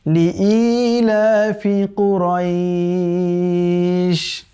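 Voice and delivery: slow, drawn-out melodic tilawat; Quran recitation. Shown as a demonstration. Teacher recites the verse for students in slow melodic chant. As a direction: chant the verse slowly with long held vowels. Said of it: "আবার পড়ুন আমার সাথে".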